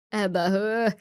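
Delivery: ordinary talk, nothing marked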